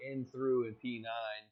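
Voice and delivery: a hint of race caster play-by-play; lightly voiced grid rundown for the stream; race commentary.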